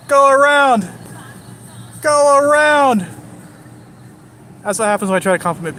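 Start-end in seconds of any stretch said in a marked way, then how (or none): 0.1-3.1 loud, much higher, drawn-out calls; calling out. Real, not acted. Telling cars behind him to pass, loud calling out. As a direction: wave them past with a big call.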